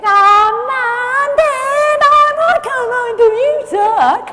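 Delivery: high, whining mock teen voice; whiny teenager. Mock teen whining to parents at home, high and whiny. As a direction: whine it like a sulky teen at home. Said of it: "your teenager comes up and goes".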